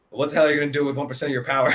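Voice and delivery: fast mocking jab; quick, lightly voiced ribbing; teasing Hoshina. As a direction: rattle it off as a tease. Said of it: "Hoshina's just like".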